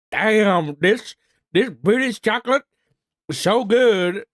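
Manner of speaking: imitating an impressed American accent. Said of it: "'cause that's what Americans sound like".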